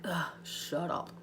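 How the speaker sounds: slow, mock-irritated groan; mock annoyed. Coach mock-scolding her own voice, annoyed. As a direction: grumble at yourself, with love. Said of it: "I'm like".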